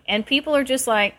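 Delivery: ordinary delivery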